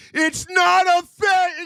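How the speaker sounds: high, loud mock scream, stretched out; emo teen scream. Emo friend yelling at his mom, screamed. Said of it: "literally screamed at his mom".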